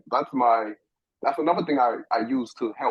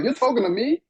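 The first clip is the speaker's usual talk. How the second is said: surprised question; 12 semitones higher, 3 dB louder than usual. Retold or imitated. Surprised reply in retold story.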